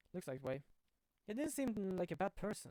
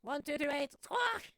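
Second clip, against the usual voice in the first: high parrot reciting numbers, then a squawk; 8 semitones higher, 5 dB louder, slower than usual. Acted. Parrot telling the safe number, high squawking parrot voice. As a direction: rattle off the digits like a parrot. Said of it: "Polly, what's the number of the safe?".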